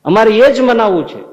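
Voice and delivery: loud, high insistence stressing એ; firm loud demand. Acted. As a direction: put your foot down loudly. Maharaj repeating demand.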